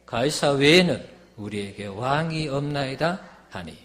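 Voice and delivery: loud, declamatory Bible reading; forceful scripture reading. A narrator's reading. Preacher reading Pilate's trial to the congregation, loud and declamatory.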